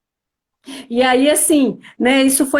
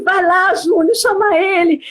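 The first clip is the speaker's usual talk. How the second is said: higher-pitched, eager prodding; urgent request, retold; 6 semitones higher than usual. Wife sending a friend to fetch her husband, urging. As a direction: send him off with a quick plea.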